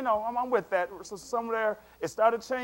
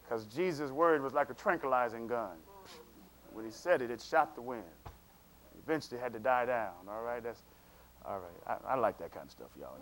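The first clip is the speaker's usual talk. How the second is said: low aside; 10 semitones lower, 10 dB quieter, faster than usual. Real, not acted. Preacher drops into low aside.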